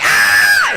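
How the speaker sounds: loud mock terrified scream; short hammed-up shriek; girl's scream. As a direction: imitate a frightened girl screaming. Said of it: "She's like".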